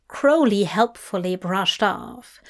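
ordinary delivery, nothing marked

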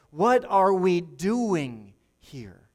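"what are we doing" slow and loud, each word weighted; emphatic, drawn-out question. Shown as a demonstration. Preacher challenging the church to remember its purpose, slow emphatic question. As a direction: press hard and slowly on every word of "what are we doing". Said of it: "the emphasis on the right syllable".

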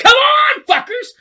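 high, loud challenging shout; tough Eddie Brock. Retold or imitated. Tough Eddie Brock yelling at enemies, shouted. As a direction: call them out like a brawler. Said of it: "he'd still be like".

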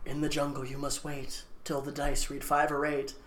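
low, hushed game-board verse; ominous riddle. Retold or imitated. Reading out the cursed game's riddle, hushed and ominous. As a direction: intone the rhyme softly and low. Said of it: "it's like".

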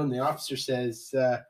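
ordinary talk

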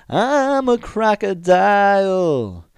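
high, loud, slow and drawn-out; creepy crocodile line. Retold or imitated. Shawn staring at the girl, loud and high and slow. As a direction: belt it at her, dead serious and unblinking. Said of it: "look at the girl and he goes".

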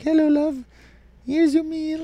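high, sugary housewife greeting; sweet wife voice. Pretend. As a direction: coo it like a doting apron-clad wife. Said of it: "and she's like".

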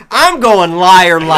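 loud, slow triumphant pick call; real excited draft call; excited announcement. Real, not acted. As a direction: slam the pick down with glee.

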